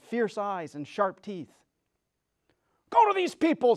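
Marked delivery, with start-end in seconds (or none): 2.9-3.8 high, loud witch's command. Acted. Witch ordering her wolves, high and loud. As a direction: bark orders like a wicked witch. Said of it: "said the witch".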